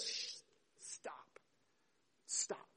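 whispered hush, then a soft, firm stop; Jesus hushing objectors. Acted. Jesus silencing the complaining disciples, hushed command. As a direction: quietly shush them, leaving pauses between words. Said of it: "And Jesus says".

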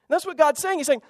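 normal talking voice

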